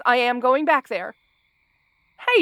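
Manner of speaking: ordinary talk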